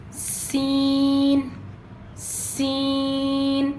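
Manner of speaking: سین with a long hissing s, drawn out slowly; whistled s. Shown as a demonstration. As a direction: stretch سین, letting the s whistle. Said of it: "سی ٹی کی آواز آئے گی". (سین لمبا کھینچ کر سیٹی والی آواز)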